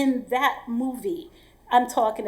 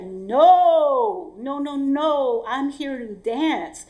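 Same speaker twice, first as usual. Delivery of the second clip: long drawn-out, loud first "no", then slow insistence; emphatic refusal; 2 semitones higher, 6 dB louder, much slower than usual. Retold or imitated. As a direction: refuse firmly, stretching it out. Acted refusal to a demanding client.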